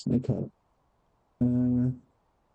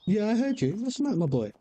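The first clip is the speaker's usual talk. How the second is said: higher, sing-song coo to the cat; pet talk; 8 semitones higher, faster than usual. Real, not acted. Owner coaxing his cat Apollo, in a high cooing pet voice.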